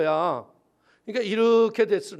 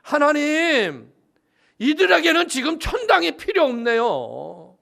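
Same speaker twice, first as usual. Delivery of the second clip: pleading, impassioned prayer voice; earnest prayer; 5 semitones higher, 6 dB louder than usual. Retold or imitated. Pastor praying for the poor family, impassioned plea. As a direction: cry out to God from the heart. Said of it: "조 목사님이 생각한 게 뭐냐 하면".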